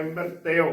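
ਮ੍ਰਿਤਯ as mirt-te-o, ending in o; correct pronunciation. Shown as a demonstration. Teacher models the aunkar ending, careful pronunciation. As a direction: end ਮ੍ਰਿਤਯ on te-o. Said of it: "ਮ੍ਰਿਤਯ ਉਚਾਰਨ ਕਰਨਾ ਹੈ".

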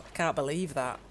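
normal talking voice

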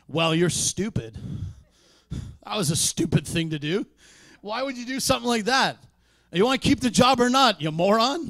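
loud, high, fast berating; mock angry tirade at a coworker; harsh scolding. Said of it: "we talk like this".

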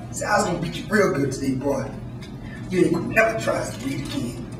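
low, soft threat, fast then slowing; quiet menacing master. Acted. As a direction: growl a quiet promise of a beating. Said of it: "The master said".

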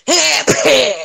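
loud, high mock battle cry; mocking the Russian enemy yell; mock Russian soldier. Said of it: "They got fucking Russian with the AK going".

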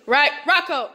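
loud call to a dog. Real, not acted. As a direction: call the dog's name loudly. Calling the dog by name.

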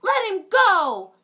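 high, loud command; demanding Dot. Acted. Dot ordering the bear to release Wolfie, loud. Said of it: "Dot demanded".

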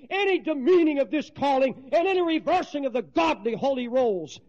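preacher declaring before the congregation, full force; preaching at full force. Acted. Preacher exhorting.

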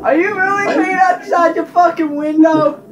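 high, loud shocked question; can't believe what he sees; shocked disbelief. Real, not acted. Shocked at friend peeing outside.

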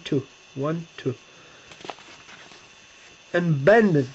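ordinary talk, nothing marked

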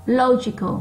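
LO in "logical" high, then falling; stressed first syllable. Shown as a demonstration. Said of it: "nó to hơn những âm còn lại". (nhấn mạnh âm một của "logical")

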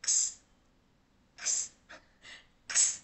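"x" as a voiceless k snapping into s; isolated k-s cluster drill; joined k-s sound. Shown as a demonstration. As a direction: say "x" as only "ks", no vowel. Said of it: "âm cờ sờ nó liền với nhau".

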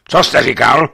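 bristling officer's challenge; bristling demand. A narrator's reading. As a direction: snap the question back, bristling. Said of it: "naježil se".

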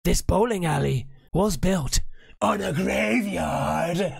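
halting build to a long-drawn mock-horror cry; pause, then stretch out the ghostly punchline; spooky reveal. Retelling what the freaked-out bowling staff said, hammed-up spooky. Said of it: "But then they said".

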